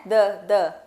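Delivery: D said as a crisp Portuguese d; tongue behind teeth, clear stop D; plain Portuguese D. Shown as a demonstration. Teacher showing the Portuguese D to a student, crisp and plain. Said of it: "lembrar do, da letra D".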